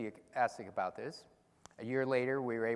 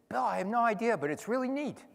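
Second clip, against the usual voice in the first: put-on eager young scientist; 9 semitones higher, 4 dB louder than usual. Scientist telling his director he has no idea but it's neat, eagerly. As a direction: pitch jumps way up, bright and louder. Retelling eager reply to boss.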